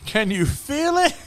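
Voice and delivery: high, drawn-out mock incredulous question; mocking echo. Patient throwing the dentist's question back, exaggerated mocking. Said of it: "I just looked at him like".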